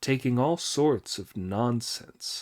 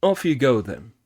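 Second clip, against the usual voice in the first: louder kindly dismissal; Sanzang sending off; 6 dB louder than usual. Acted. Sanzang sending Monkey off, louder. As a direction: send him off gladly. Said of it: "said Sanzang".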